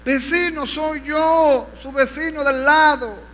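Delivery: high, pleading wail; acted anguished cry. Damned neighbor making himself known to the evangelist, high anguished wail. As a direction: plead loudly upward, as if weeping hard. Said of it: "el vecino lloraba fuerte".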